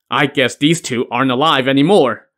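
ordinary delivery, nothing marked

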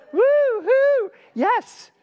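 loud high cheerful whoop; excited whoop. Real, not acted. Cheering the congregation with a loud whoop. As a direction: whoop to fire up the room.

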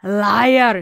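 a loud furious cry of "Liar!"; furious shout. Acted. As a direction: spit out the accusation, loud. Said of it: "she shouted".

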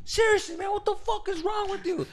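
high mock-angry bouncer voice; exasperated guard. Bouncer grabbing him again, high mock anger. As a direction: play the fed-up bouncer.

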